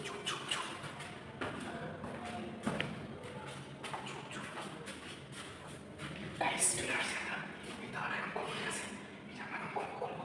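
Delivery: breathy secret whisper. Real, not acted. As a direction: whisper while sneaking in. Sneaking toward the room.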